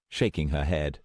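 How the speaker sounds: usual speaking voice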